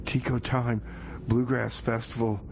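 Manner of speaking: normal talking voice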